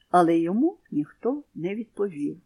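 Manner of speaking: plain reading voice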